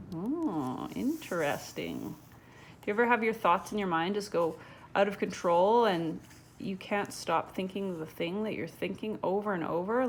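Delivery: ordinary delivery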